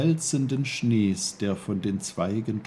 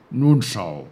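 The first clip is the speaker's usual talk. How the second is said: louder put-on uncle voice; emphatic character question; Onkel Pfefferkorn; 10 dB louder than usual. A narrator's reading. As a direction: ask briskly as the little uncle. Uncle starts demonstrating.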